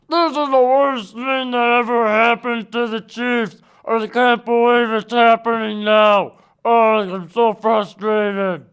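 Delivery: lightly put-on whiny Mahomes; a hint of a mopey quarterback whining; mock whiny star quarterback, voice barely changed; Patrick Mahomes voice. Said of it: "Excuse me for the Patrick Mahomes voice".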